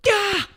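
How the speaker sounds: very high sharp yelp; sudden high "ah"; frustrated yelp. Retold or imitated. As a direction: let out a pained squeak of annoyance. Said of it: "Slight moment of going".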